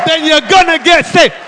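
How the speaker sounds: high, emphatic Jamaican preaching; Jamaican accent. Retold or imitated. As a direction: proclaim it in a put-on Jamaican accent. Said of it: "without a Jamaican accent".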